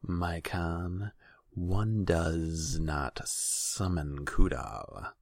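slow, drawn-out serpent hiss; snake-like advisor, hissed slowly. A narrator's reading. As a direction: caution the ruler with long sibilants. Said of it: "A voice hissed in the shadows".

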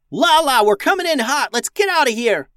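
loud, high urgent call; yelling into comms. A narrator's reading. Max yells to Lala over comms to take off. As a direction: shout the escape order. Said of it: "Max yelled into her comms".

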